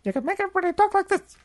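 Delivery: very high, fast squeaky chatter; chipmunk-fast, high-pitched voice; sped-up tape voice. Retold or imitated. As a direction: imitate a tape in fast motion. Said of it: "play in fast motion".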